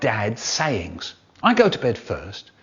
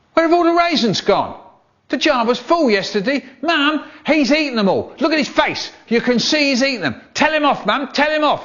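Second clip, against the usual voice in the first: whiny little brother; 12 semitones higher than usual. Acted. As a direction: whine it to Mum like a wronged kid. Brother whining to Mum.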